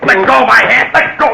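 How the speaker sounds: high, loud strained cry while wrestling; struggling shout. Acted. Struggle over a gun.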